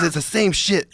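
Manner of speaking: high, exasperated mock retort. Key holder brushing off the name correction, exasperated.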